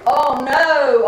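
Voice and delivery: ordinary talk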